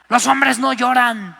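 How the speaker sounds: ordinary delivery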